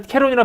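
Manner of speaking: "캐론"의 첫소리를 거센 ㅋ로; "캐론"을 숨이 터지는 ㅋ로 또렷하게; 거센소리 발음 시범. Shown as a demonstration. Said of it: "캐론이라 발음했다면 이 사람은". ("캐론"의 ㅋ를 거세게 발음)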